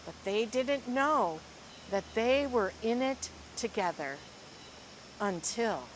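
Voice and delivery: lively, expressive storybook reading; reading to children. Acted. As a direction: read it like a picture book to a class. Teacher reads picture book aloud.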